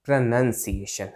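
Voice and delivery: low प्रोनंसिएशन with 'nun' in the middle; correct pronunciation. Shown as a demonstration. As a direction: model प्रोनंसिएशन as pruh-NUN-siation. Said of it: "ऐसे करके". (बीच में 'नन', सही उच्चारण)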